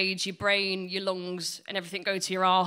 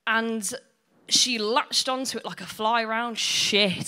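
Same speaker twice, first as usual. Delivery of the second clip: normal talking voice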